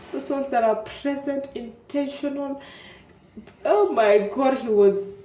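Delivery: gushing. Real, not acted. Praising the man she lost, excited gush. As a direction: burst out in admiration.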